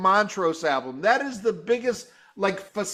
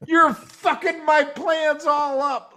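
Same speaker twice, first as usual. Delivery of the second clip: higher, slow laughing curse; mock outrage; 9 semitones higher, slower than usual. Host retelling his annoyance at the TV, mock angry. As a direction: fake fury at ruined plans. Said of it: "I'm like".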